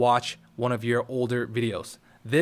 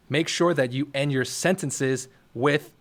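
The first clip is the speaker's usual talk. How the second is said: usual speaking voice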